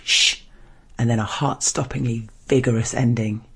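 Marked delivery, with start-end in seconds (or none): 0.0-0.4 sharp, forceful hissed shush; hissed shh. A narrator's reading. He silences the narrator with no teasing, sharply hissed. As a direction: hiss a firm shh with no playfulness. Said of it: "Just one hissed".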